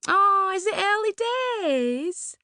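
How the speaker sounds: put-on high, breathy-bright posh young woman; a high, gushing party girl; Suzanne's gushing voice. To Laurie at the party, a high gushing voice.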